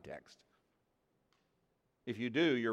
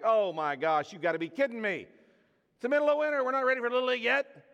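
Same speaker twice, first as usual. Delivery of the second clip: high, loud disbelieving complaint; exasperated whine; 9 semitones higher, 5 dB louder than usual. Retold or imitated. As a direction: groan it out, fed up with the interruption. Exasperated reply to wife.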